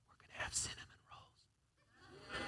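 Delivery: slow secret whisper, as if sharing a treat; a slow conspiratorial whisper about cinnamon rolls; playful whisper. Acted. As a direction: lean in and whisper the good news. Teasing treat announcement mid-sermon.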